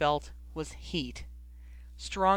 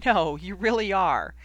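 pressing; 3 semitones higher, 5 dB louder than usual. A narrator's reading. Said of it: "Ginny pressed".

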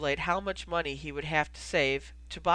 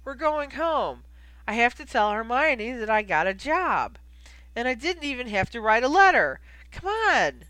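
high, loud, elated urging; thrilled and insistent; excited; 7 semitones higher, 6 dB louder than usual. Acted. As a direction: burst with the good news. Delighted Ron urges friend home.

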